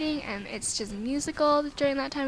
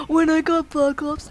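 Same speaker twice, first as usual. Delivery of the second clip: mock excitement; 4 semitones higher, 7 dB louder than usual. Mock gasp, then "just kidding.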